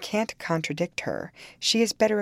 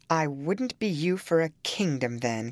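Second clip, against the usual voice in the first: plain reading voice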